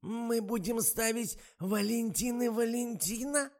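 высокий, медленный радостный старушечий голос; радуется, как глуховатая старушка; обрадованная старуха. A narrator's reading. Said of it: "обрадовалась Ада Марковна". (высокий восторженный старушечий голос)

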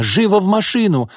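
high, urgent angry order; yelling. A narrator's reading. Mother ordering daughter into the car, yelling. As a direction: drive the child into the car. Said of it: "кричала Нина".

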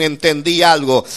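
normal talking voice

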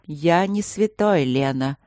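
slow, measured I'm no saint; king slowly admitting his faults; slow admission. Acted. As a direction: let each word weigh. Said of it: "медленно сказал Луциус".

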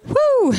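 whoop. Real, not acted. Relieved cheer after pastor's reassurance.

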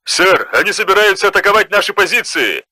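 urgent sergeant report. A narrator's reading. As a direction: report the danger fast and tense. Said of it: "доложил сержант".

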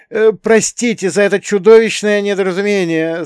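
high, loud desperate blurt; despairing high-pitched cry; desperate apology. A narrator's reading. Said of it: "с отчаянием в голосе выпалил".

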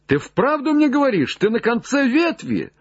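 very high, eager question; exclaiming cry. Acted. Legrand asks if Jupiter reached the end, high cry. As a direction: cry up, urgent to confirm it. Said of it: "вскричал Легран".

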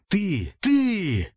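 high, drawn-out accusing cry. A narrator's reading. Grieving prince accuses his father's killer at the coffin, high accusing cry. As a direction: cry out high, falling away, without extra volume.

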